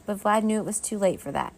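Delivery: ordinary delivery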